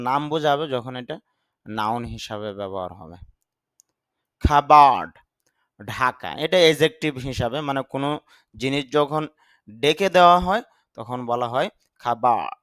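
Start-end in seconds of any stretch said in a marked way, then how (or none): none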